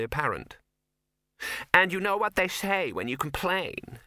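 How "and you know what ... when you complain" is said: demanding. A narrator's reading. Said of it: "she demanded".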